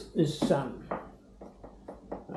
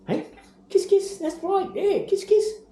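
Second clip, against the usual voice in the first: put-on baby talk to a bird. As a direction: coo for kisses from the parrot. Asking parrot for kisses.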